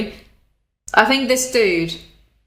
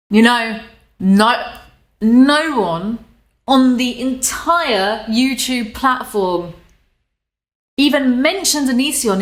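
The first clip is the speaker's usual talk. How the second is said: dripping with sarcasm; 3 semitones higher, slower than usual. Shown as a demonstration. Showing a reporter how sarcasm sounds, slow and exaggerated. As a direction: lay the sarcasm on thick, stretching every vowel. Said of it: "That is dripping with salt".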